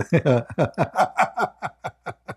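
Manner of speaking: rising burst of helpless laughter. Real, not acted. Streamer cracking up at a viewer's joke, helpless laughter. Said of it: "laughing so hard I can't even breathe".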